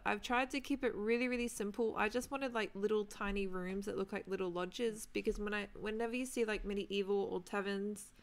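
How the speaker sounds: ordinary talk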